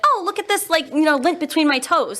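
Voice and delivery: put-on high, eager kid voice; mock kid. Teacher imitating kids eager to show gross things. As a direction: play the excited child showing off.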